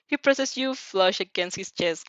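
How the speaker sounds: plain reading voice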